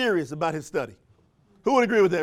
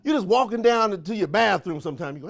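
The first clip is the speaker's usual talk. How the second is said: usual speaking voice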